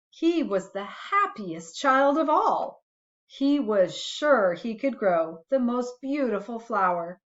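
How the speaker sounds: put-on gentle read-aloud for a child; reading a bedtime story to a little one; storybook reading. A narrator's reading. Parent reading a picture book to a child, gentle storytelling.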